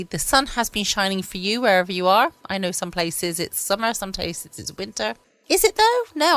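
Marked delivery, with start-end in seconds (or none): 5.5-6.4 sudden doubt. Real, not acted. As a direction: pitch leaps up in surprised doubt. Doubting her own seasons claim.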